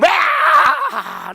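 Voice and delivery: high shocked wail; imitated frightened child. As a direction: yell like a child jolted by electricity. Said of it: "dia ketakut". (jeritan anak ketakutan yang ditirukan)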